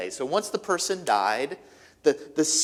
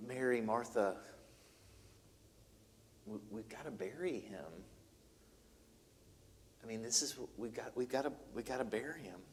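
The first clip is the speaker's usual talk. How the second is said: soft, low voice breaking bad news; hushed, gentle news; 5 semitones lower, 15 dB quieter than usual. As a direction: tell the grieving sisters gently. Mourners gently telling the sisters.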